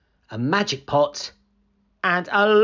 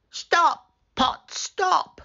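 high, firm call to stop; telling the magic pot to stop, high firm command; much slower than usual. Acted. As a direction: order the pot to halt, high and emphatic.

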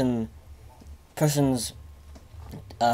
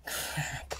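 ordinary talk, nothing marked

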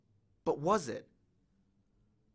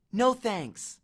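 put-on curt girl; 5 semitones higher than usual. A narrator's reading. Annabeth refusing.

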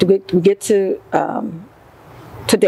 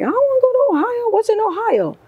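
high fast whiny protest; high quick protest about Ohio. Retold or imitated. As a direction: complain like a reluctant wife. Protest at moving.

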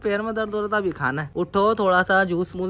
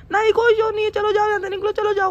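high falsetto wife voice shooing him off; mimicking wife; 12 semitones higher than usual. Husband mimics his wife throwing him out, high falsetto. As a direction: shoo him away in a squeaky wife voice. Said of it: "over acting करता है".

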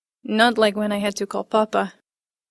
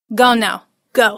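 loud curt shooing; loud furious father shooing the children away; furious dismissal; 7 dB louder than usual. A narrator's reading. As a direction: send them off in a temper. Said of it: "waved us away furiously".

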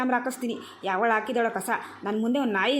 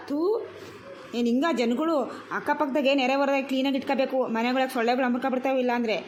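ordinary delivery

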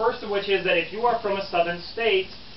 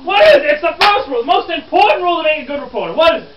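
high, loud, insistent questioning; exasperated demand; 10 semitones higher, 12 dB louder than usual. Acted. Reporter demanding the first rule of reporting, exasperated. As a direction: press the class loudly for the answer.

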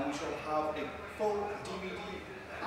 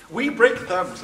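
mock gangster; 6 semitones higher, 12 dB louder, much slower than usual.

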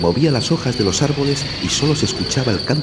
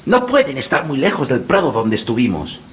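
put-on boy voice; 7 semitones higher than usual. A narrator's reading. Hansel comforts his sister.

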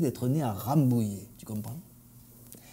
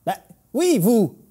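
impatient director; 7 semitones higher, 4 dB louder than usual. Acted. Director answering singer impatiently.

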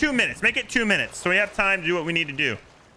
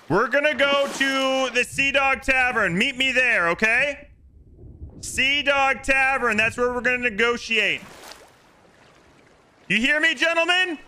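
calling out orders; 6 semitones higher than usual. Real, not acted. Player giving the rival crew a meeting place and deadline, calling out. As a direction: announce terms to a distant crew.

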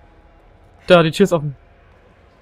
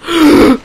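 startled gasp; 11 semitones higher, 10 dB louder than usual. Real, not acted. Sudden scare in horror game.

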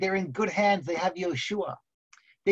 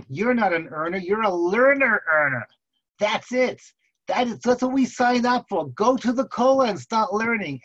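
higher, louder exasperated telling-off; scolding wife; 4 semitones higher, 5 dB louder than usual. Acted. Wife orders husband back to learning, exasperated scolding. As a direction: lay down the law to a husband, pitch climbing.

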